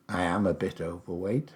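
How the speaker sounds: ordinary talk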